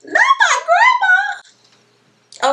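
sudden high, loud cry of the mother; mother's outburst. Retold or imitated. Mother threatening to call grandma, high loud yell. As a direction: shriek it like the furious mother. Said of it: "she said".

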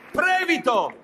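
high call across the room. Acted. Calling the assistant. (richiamo acuto)

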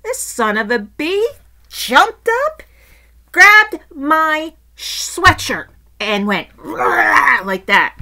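loud, high, drawn-out outrage; worked-up, heavily stretched words; outraged retelling. Real, not acted. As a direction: punch out each phrase slowly, indignant. Angry retelling of the dog attack.

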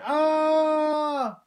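sudden high shrill scream of terror. A narrator's reading. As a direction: scream out in fright. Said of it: "the boy screamed again".

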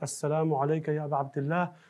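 ordinary talk, nothing marked

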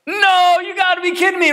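disbelieving outburst at a misfire; exasperated disbelief. Retold or imitated. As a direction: blurt it out like you can't believe it. Gun misfires again, exasperated.

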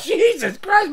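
usual speaking voice

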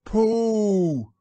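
high, loud, long-held cry; overjoyed shout. A narrator's reading. Christopher Robin finding his lost friend, overjoyed. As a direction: shout the name in delighted relief.

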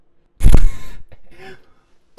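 shrill high cackle; squealing laugh. Real, not acted. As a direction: burst into squeals.

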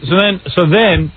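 normal talking voice

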